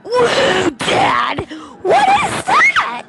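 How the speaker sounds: loud, very high amazed yell; excited shout. Retold or imitated. Son yelling to dad about the golden texture, amazed. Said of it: "and I was like".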